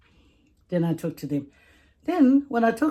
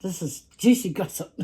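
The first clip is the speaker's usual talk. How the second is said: ordinary delivery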